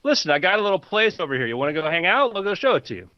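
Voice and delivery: lightly voiced friendly jungle bro; Steve. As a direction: pitch the voice up and make a casual invitation. Game master plays Steve.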